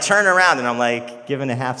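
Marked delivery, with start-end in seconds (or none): 0.0-0.6 eager command. Retold or imitated. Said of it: "he's like".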